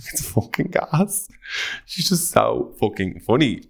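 breathless giggle through words; laughing. Real, not acted. Host cracking up telling listeners.

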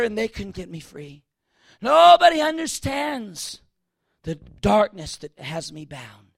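loud, driving sermon delivery; preaching at full force. Real, not acted. Preacher shouting at his congregation, full force. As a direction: preach it hard to the whole room.

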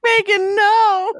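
high, loud wail of her name; mock crying plea. Quoting Rita begging Megan, high mock wail. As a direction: wail it like a desperate friend. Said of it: "Rita's like".

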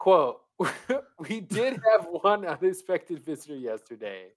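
can barely read for laughing; reading in disbelief. Real, not acted. Giggling through quoted line.

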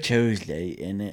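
put-on jokey London accent; mock London accent. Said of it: "London stereotype".

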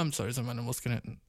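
ordinary delivery

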